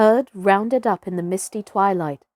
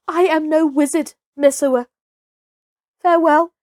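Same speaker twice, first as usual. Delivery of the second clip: urgent farewell; 7 semitones higher, 4 dB louder than usual. Acted. Mowgli urging Messua away.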